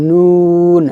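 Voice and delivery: long "নুন" with fully rounded lips. Shown as a demonstration. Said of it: "সম্পূর্ণ ঠোঁট গোল করে উচ্চারণ করব". (ঠোঁট গোল করে লম্বা "নুন")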